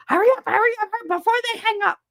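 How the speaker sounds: very high, urgent mock holler; frantic relative, imitated. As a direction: nag in a panicky high voice. Imitates relative urging her to answer.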